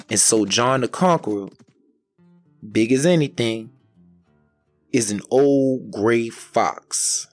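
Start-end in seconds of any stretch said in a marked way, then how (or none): none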